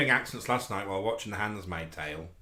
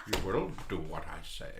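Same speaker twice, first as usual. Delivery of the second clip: Aunt Lydia; 5 semitones lower, 5 dB quieter than usual. Acted. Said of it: "Do Aunt Lydia?".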